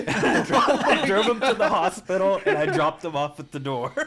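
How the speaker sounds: laughing through the words; can't stop laughing as he tells it. Real, not acted. Telling friends how he dropped the dealer at the hospital, laughing.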